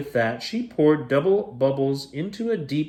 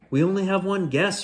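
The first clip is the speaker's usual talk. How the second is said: fretting; 8 semitones higher than usual. Acted. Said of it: "fretted Parrot Polly".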